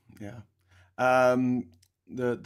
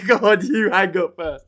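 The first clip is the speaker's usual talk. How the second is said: giggly late night call; 5 semitones higher, 8 dB louder than usual. Retold or imitated. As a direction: giggle through a mock lovers' goodbye. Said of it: "Is how I imagine it went".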